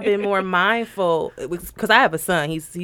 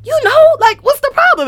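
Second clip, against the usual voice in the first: much higher, insistent demand; retold nagging, pitched way up; exasperated parent; 14 semitones higher, 5 dB louder than usual. Mother retelling how she presses her son, exasperated.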